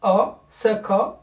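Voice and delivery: "saka" drawn out slowly, each syllable clear; slow syllable demo. Shown as a demonstration. Teacher to class, building the word slowly, syllable by syllable. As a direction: spell out "saka" slowly, sa then ka. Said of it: "avec fatha".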